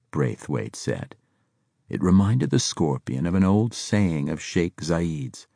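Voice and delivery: ordinary delivery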